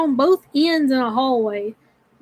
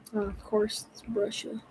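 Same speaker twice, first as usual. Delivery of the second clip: low, very soft mutter; deep voice; 4 semitones lower, 14 dB quieter than usual. Real, not acted. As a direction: drop into a deep, quiet voice. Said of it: "my voice got so deep there".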